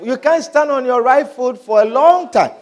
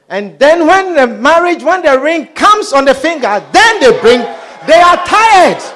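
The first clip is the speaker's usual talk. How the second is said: building up to a loud cry; preacher building to a loud peak; rising shout; 6 semitones higher, 5 dB louder than usual. Real, not acted. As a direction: climb from raised to shouting.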